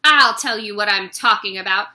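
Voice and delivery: fast loud retort; angry retort. Acted. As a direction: snap back at him. Headmistress retort.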